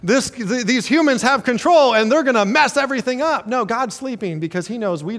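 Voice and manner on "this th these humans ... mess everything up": high, loud alarmed cry; hammed-up fret over the humans; mock panicking God. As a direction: throw up your hands in fake alarm. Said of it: "He'd be like".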